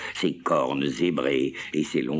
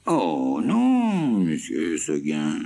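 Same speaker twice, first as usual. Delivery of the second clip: très aiguë, puis traînante; chèvre plaintive; 2 semitones higher, much slower than usual. Acted. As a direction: minauder un « oh non » aigu. La chèvre répond. (la chèvre répond, aiguë et traînante)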